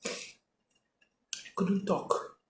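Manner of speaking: breathless, almost voiceless and very slow; breathing hard. Real, not acted. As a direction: gasp the words out with no breath left. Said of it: "breathing hard".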